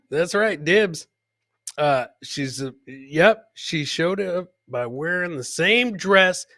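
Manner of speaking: usual speaking voice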